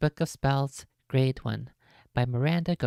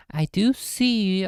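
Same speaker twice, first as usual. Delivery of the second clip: slow and loud; 5 semitones higher, 5 dB louder, slower than usual. Real, not acted. Slow emphatic aside.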